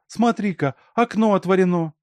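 high, loud exclamation; alarmed discovery. A narrator's reading. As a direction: call out the alarming find. Alyonka shouts discovery to yard-keeper. (встревоженно, высоко и громко)

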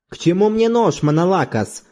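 rising irritation, pitched high; gradually heating up. A narrator's reading. Zorbas answering a knife challenge, getting heated. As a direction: begin to flare up, voice lifted. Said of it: "мало-помалу распаляясь".

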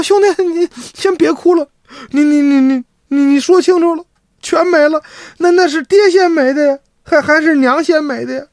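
high, tearful stammering sister. Acted. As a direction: ask through tears which parent died. Sister tearfully asks who died.

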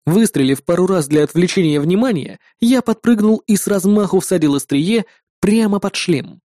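usual speaking voice

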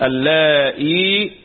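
اللائي with a kasra hamza and a long final ee; hamza then ya. Shown as a demonstration. Teacher showing students a Quranic reading, اللائي with hamza and long ya. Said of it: "بهمزة مكسورة وياء بعدها".

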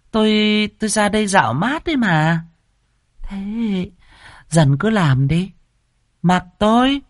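high, coy lady of the house voice; giọng bà ba. Acted. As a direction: play the mistress, sweetly evasive. (giọng bà ba cao, điệu đà lảng tránh)

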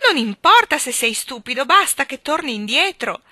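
high, loud desperate plea; queen begging her husband to return; imploring. A narrator's reading. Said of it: "ella implorò".

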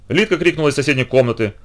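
plain reading voice

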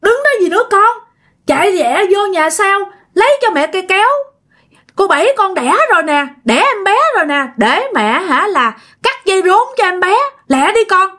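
high-pitched urgent orders, reenacted; urgent calling. Possessed mother ordering her child to fetch scissors, urgent high call. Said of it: "Cái mẹ mới kêu chị".

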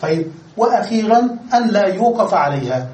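normal talking voice

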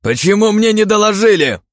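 high, loud indignant yell; indignant shout. Acted. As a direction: demand it furiously. Lord shouts at guards.

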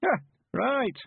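put-on high, slow creaky old countryman; old man voice. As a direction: play a cheerful old villager.

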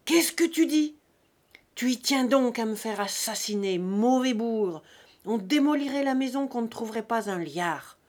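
loud, thundering father's rage; furious shouted ultimatum; terrible wrath. A narrator's reading. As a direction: roar the threat at a rebellious son.

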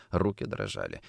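usual speaking voice

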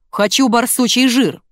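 loud, high, slow demand; barked demand. A narrator's reading. Customer insisting on her badger fat, barking. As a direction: bark it stubbornly. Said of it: "гаркнула покупательница".

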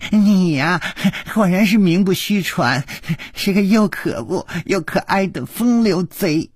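put-on high-pitched girlish giggle through the words; giggling. A narrator's reading. Girl teasing the charming rogue, giggling. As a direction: tease him, bubbling with giggles. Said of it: "绛衣少女咯咯地笑着说".

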